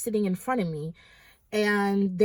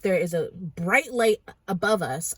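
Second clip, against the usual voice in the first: usual speaking voice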